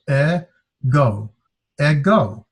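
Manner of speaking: e, pause, go, then ego joined; careful two-step ego for learners; syllable by syllable. Shown as a demonstration. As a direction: sound out e and go before blending ego. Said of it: "two vowels and two syllables".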